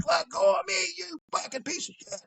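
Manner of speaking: high mock kid protest; high-pitched, squawked retold demand; struggling kid. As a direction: imitate a kid being dragged off. Kid dragged back struggles.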